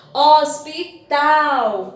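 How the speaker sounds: hospital slow and loud, ending on a long tal; last syllable stressed. Shown as a demonstration. Teacher proving the last syllable is strong, hospital with stressed tal. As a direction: sound out hospital, leaning on the tal. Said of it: "Então, a última sílaba".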